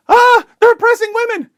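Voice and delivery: high, loud mocking shriek of alarm; mock scream. Commentator mocks a Western journalist at the Taliban, high loud mock scream. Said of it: "goes to the Taliban and screams".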